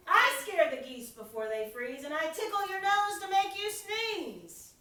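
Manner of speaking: the wind shouting her powers at Bear; mighty shout. A narrator's reading. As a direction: boast at the top of your voice. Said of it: "with a whirling, twirling, mighty shout".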